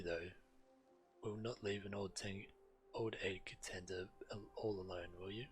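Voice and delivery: ordinary delivery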